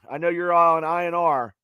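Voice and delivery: ordinary delivery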